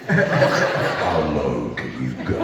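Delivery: very deep, drawn-out low voice; how low can you go. Retold or imitated. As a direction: drag it slowly down to the bottom of your range. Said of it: "You just see Bob go".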